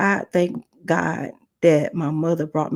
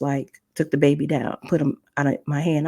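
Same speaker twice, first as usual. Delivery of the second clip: ordinary talk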